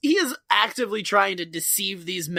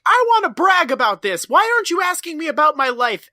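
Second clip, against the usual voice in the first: high, loud, fast mock yelling; 8 semitones higher, 6 dB louder than usual. Barney yelling at Floyd to ask about his life. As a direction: yell it like a bragging guy demanding attention.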